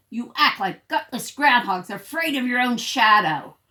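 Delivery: loud, sneering insult; rude bully. Acted. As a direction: taunt them like the neighborhood bully. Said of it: "He talks very rudely".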